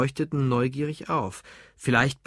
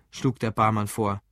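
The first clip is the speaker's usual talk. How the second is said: ordinary delivery, nothing marked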